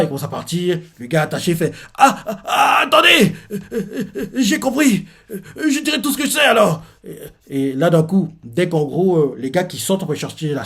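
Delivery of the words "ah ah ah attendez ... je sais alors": supplication paniquée très aiguë, rire nerveux; panique. Retold or imitated. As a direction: imite un prisonnier qui craque de peur. Prisonnier paniqué qui cède. (le prisonnier supplie, terrifié et aigu)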